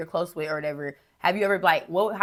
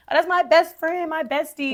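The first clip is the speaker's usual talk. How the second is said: high gushing best-friend voice; mocking bestie voice; 10 semitones higher, 4 dB louder than usual. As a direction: gush it like a teen girl. Mocking people who say bestie.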